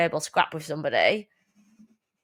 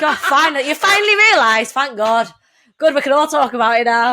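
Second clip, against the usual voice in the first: higher, louder, laughing mock relief; relieved parents; 6 semitones higher, 10 dB louder than usual. Parents cheering their son finally coming out. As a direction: gush like parents who finally got told. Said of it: "parents relieved son came out".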